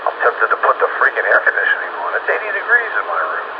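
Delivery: ordinary talk, nothing marked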